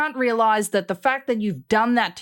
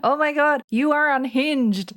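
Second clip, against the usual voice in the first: ordinary talk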